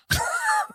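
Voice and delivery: high-pitched squealing laugh. Real, not acted. Comic cracking up at his own story, high squealing laugh.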